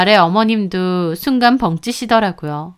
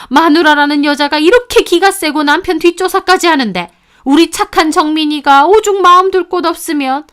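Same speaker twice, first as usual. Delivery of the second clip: scornful blame; 6 semitones higher, 3 dB louder than usual. A narrator's reading. Mother-in-law blames wife.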